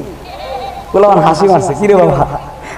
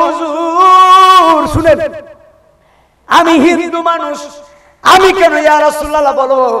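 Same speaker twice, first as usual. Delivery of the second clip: voicing the barber; 10 semitones higher, 3 dB louder than usual. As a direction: draw the barber's words out high.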